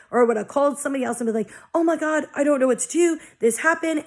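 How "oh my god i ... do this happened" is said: mock frantic, higher and a bit louder; imitating old freakout. Calling someone about the leak, mock frantic and high. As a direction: play her past self losing it on the phone. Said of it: "I literally would have lost my shit".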